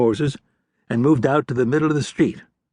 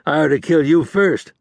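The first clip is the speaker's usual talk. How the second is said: mild angry yell, a bit louder; angry shout; 6 semitones higher, 4 dB louder than usual. A narrator's reading. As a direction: snarl back at the traitor. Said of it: "the outlaw shouted in reply".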